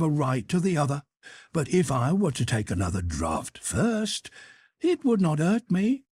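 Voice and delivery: tipsy cook making excuses; Gretel's sly excuse. Acted. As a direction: reason your way to the second fowl. Gretel justifying eating second fowl.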